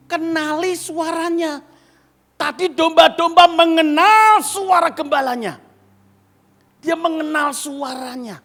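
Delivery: full-force sermon line; preaching at full force. Real, not acted. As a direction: proclaim it to the congregation. Preaching emphasis.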